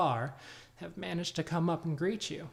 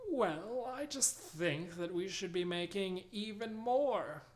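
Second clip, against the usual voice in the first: put-on older halfling voice; 6 semitones higher, slower than usual. Reginald argues with Anita.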